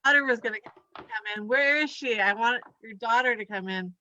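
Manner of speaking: ordinary talk, nothing marked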